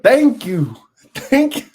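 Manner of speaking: loud, high-pitched hammed-up thanks; jokey overjoyed outburst; mock gratitude. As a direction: play exaggerated relief and gratitude, laughing. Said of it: "I'd be like".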